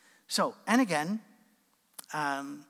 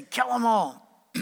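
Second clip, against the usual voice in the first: louder, higher, slow mock battle cry; mock war cry; 6 semitones higher, 8 dB louder, much slower than usual. Preacher quoting scripture's war command, in a slow, louder mock cry. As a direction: drag out a mock command to slaughter. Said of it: "passages that say".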